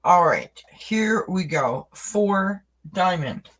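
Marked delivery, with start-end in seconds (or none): none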